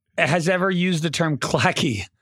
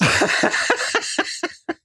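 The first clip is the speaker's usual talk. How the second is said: breathy laugh; 12 semitones higher than usual. Real, not acted. Laughing at the email menace bit.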